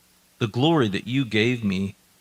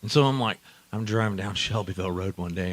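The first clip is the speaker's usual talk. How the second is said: ordinary talk, nothing marked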